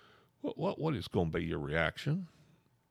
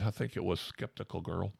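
plain reading voice

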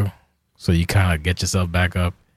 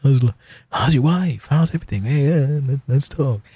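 higher and louder, bubbly friendly chatter; chatty drunk greeting; 8 semitones higher, 3 dB louder than usual. Retold or imitated. Tipsy self greeting people at a party, bubbly and loud. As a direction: gush at everyone like the life of the party. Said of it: "I become real, like, a social butterfly".

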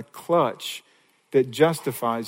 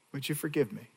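softly repeated prayer, much softer; quiet plea; 11 dB quieter than usual. Shown as a demonstration. Preacher repeating David's prayer to God, soft and hushed. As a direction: let it fall to a hushed plea. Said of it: "God, would you forgive me?".

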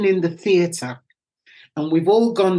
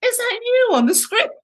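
excited friends. Retold or imitated. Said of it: "text messages".

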